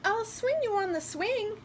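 put-on high little-girl voice. A narrator's reading. Girl character offers in story.

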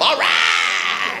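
low loud triumphant shout; kid's cheer. Acted. Child winning, loud triumphant shout. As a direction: whoop in victory.

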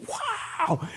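soft, very high drawn-out wow; amazed wow. Acted. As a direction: let it float up in wonder. Acts amazement at the healing.

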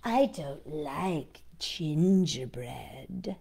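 slow, sly whisper; sly whispered fox. Acted. The fox lying to the gingerbread man, slow sly whisper. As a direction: whisper the lie slowly.

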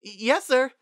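high, loud stammer; startled obedience. A narrator's reading. Twilight answering Sunset's glaring father, startled. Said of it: "snapped to attention".

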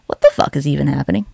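ordinary talk

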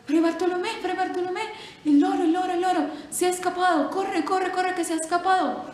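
high, loud panicked yelling; monk yelling that the parrot escaped; alarmed shout. Acted. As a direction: shout the alarm across the monastery.

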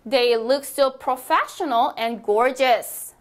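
very slow, clear dictation pace; teacher modelling a sentence slowly; slow model reading. Shown as a demonstration. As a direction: spell it out slowly for learners. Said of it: "model sentence for repeating".

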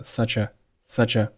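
"such a" run together as su-cha; slow linked "such a", no gap; consonant-vowel linking. Shown as a demonstration. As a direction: slide the ch of "such" into "a". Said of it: "such和a辅元连读".

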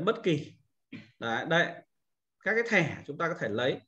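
ordinary talk

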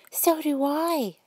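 put-on high, slow little girl agreeing; sweet child chiming in; small girl voice. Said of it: "said Cindy".

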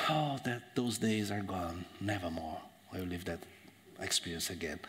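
low, soft, fading away; wistful nostalgia. Acted. Acts nostalgia for a good past.

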